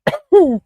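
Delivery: a burst of high, loud giggles; real giggle fit, loud and high. Real, not acted. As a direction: crack up at your own joke. Laughing at his own joke.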